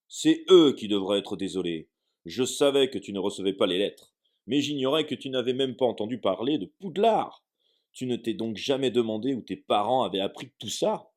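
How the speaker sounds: indignant. Acted. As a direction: let the outrage fill the room. Hagrid indignant at the Dursleys.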